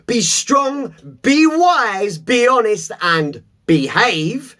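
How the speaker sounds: each "be" punched high; emphatic recitation. Shown as a demonstration. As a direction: stress each "be" like a chant. Reciting mottos from his wall.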